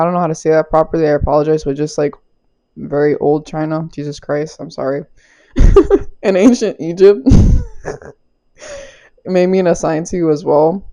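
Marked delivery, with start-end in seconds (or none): 5.5-6.2 high, louder burst of laughter; real high-pitched giggle. Real, not acted. Laughs at own apology.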